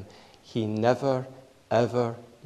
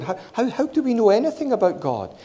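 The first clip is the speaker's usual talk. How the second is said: put-on high, quick, animated teacher voice to kids; bright questioning as to a class of children; asking children; 11 semitones higher, faster than usual. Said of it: "I was saying to the children".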